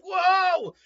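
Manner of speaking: high, loud astonished "whoa"; amazed outburst at a wild spectacle; blown-away exclamation; awed whoa. Acted. Amazed reaction at blue flame.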